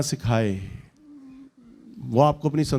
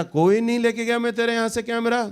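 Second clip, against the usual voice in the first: high-pitched mock denial; indignant denial; 8 semitones higher than usual. Preacher voices the friend denying taking the camera, indignant. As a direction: protest innocence in a raised pitch.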